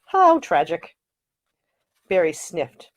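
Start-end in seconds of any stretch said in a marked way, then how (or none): 0.1-1.0 mock sorrow. A narrator's reading. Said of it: "shook his head in mock sorrow".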